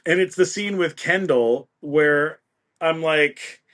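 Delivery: normal talking voice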